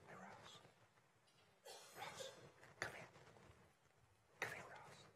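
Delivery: barely audible whisper. Shown as a demonstration. Preacher playing God calling distant Ross, whispering. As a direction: whisper it so faintly the back can't hear. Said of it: "He whispers".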